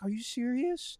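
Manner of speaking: high-pitched mock astonishment; imitated shock. Old woman hears he knows her son's movie, imitated shock. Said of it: "And she was shocked".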